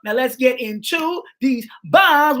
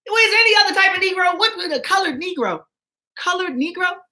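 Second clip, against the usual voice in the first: incredulous; 8 semitones higher than usual. Pretend. Mocking the quoted term.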